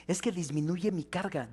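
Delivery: ordinary talk, nothing marked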